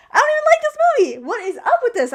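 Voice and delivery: fast, high, loud protest; exasperated outburst. Real, not acted. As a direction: burst out in baffled frustration at yourself. Exasperated at own crying over movie.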